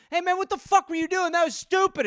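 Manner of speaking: high, mocking angry driver; sneering guy yelling from his car. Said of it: "some guy, like".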